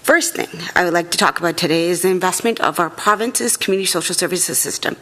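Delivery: ordinary talk, nothing marked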